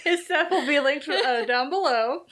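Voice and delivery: high-pitched, laughing through the words; real laughter. Real, not acted. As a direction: struggle through the plug while cracking up. Cracking up during the sign-off.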